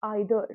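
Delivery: "either" as EYE-ther, American style; low, American-style "either" opening on "eye"; American pronunciation. Shown as a demonstration. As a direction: say "either" starting with a long "eye". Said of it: "American accent में बोलूँ".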